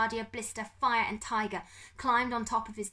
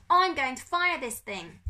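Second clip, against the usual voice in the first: loud, raised warning yell; screamed threat; 6 semitones higher, 8 dB louder than usual. Acted. Pickering about to fire gas gun, screaming. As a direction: yell it out, pitched up. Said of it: "Pickering screamed".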